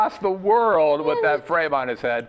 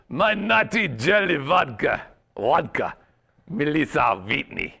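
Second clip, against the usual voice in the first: slow mock-Russian introduction; playful Russian accent; 3 semitones lower than usual. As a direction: savour it in a fake Russian accent. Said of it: "this is my Russian accent".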